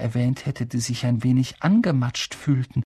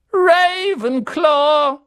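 high, loud, very slow hat voice; hat calls out; 9 dB louder, much slower than usual. A narrator's reading. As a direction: proclaim the house triumphantly. (der Hut ruft laut Ravenclaw)

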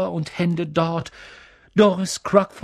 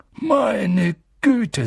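higher-pitched, drawn-out amazement; astonished; 7 semitones higher than usual. Acted. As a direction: gasp out the words in mild shock. Landlord astonished at seeing Harry.

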